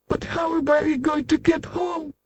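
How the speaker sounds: small and anxious; worried tiny voice. Acted. Tiny Mister Small worries about getting home, high and soft. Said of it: "a worried expression".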